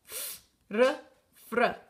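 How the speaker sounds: fr said as a short blended sound with a tapped r. Shown as a demonstration. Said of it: "juntos dicen fr". (pronuncia fr uniendo la efe y la erre)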